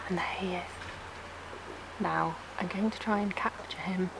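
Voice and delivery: hushed wildlife narrator voice; nature documentary. Pretend.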